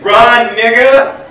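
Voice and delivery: loud, high shout at the screen; yelling at the TV. Retold or imitated. Grandmother shouting at a football game, loud fan yell. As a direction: bellow at a football player like a fan. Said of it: "yell at the T V saying".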